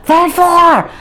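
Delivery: high, loud alarmed shout; retold yell of alarm. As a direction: shout it to the man downstairs. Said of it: "And I yell".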